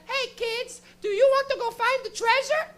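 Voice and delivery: very high, chirpy cartoon-host voice; Dora the Explorer. Cartoon girl asks the TV audience, very high put-on voice. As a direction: squeal it out like a kids' show host. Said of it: "walk up to the screen and be like".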